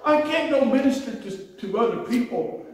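high, slow pleading cry to God; retold desperate prayer, pitched high and slowed; anguished prayer. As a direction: beg God for healing, voice breaking high.

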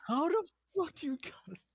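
very high outraged squeal; high indignant voice. Acted.

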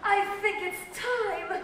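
high, slow, mildly sighing voice; sighed line. A narrator's reading. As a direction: draw it out with a touch of a sigh. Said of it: "sighed the pregnant woman".

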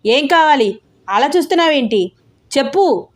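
loud demand. Acted. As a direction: press the staring boy hard for an answer. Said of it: "uncle మళ్ళీ గట్టిగా".

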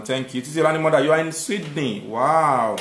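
ordinary delivery

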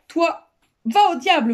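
loud, high burst of temper; angry yelling. A narrator's reading. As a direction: shout it as a furious dismissal. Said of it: "vociféra le directeur".

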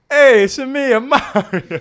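high, loud, hammy Italian Mario voice; Italian accent. Acted. Storyteller voicing Mario greeting him abroad, loud hammy Italian accent. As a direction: belt it out like a cartoon Italian plumber. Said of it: "I'm gonna stop doing the accent".

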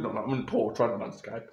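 ordinary talk

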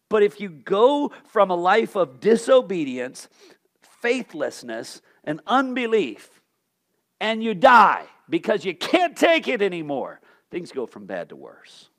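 ordinary delivery

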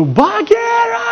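high, drawn-out bellow; long, straining mock roar; Baloo. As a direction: imitate a cartoon bear roaring out a name. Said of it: "Când urlă Bălu".

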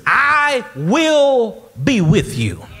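very slow, high, booming promise; loud, drawn-out declaration; loud proclamation. Acted. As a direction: stretch out each word at full preaching force. Preacher declaiming Jesus's promise.

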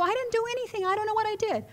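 high, helpless protest; protesting innocence. Retold or imitated. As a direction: insist you're innocent, voice pitched right up. Acting her protesting younger self.

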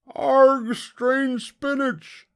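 high, slow pirate wail. A narrator's reading. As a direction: wail it like a horrified crew. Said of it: "wailed the pirates".

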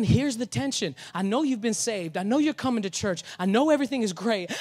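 normal talking voice

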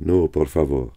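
a touch of a frightened plea, in a different voice; lightly voiced terrified plea; pleading cry. A narrator's reading. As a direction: beg him to stop, lightly voiced. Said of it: "poussa un cri".

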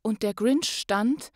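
lively rhyming read-aloud, rising to loud on the joyful parts; story narration. Acted. Podcast host reads the Grinch story to listeners, lively storytelling. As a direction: tell the Grinch tale with swelling excitement.

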